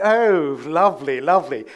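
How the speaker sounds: loud upper-crust English approval; posh English accent. Retold or imitated. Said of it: "They don't speak like that normally".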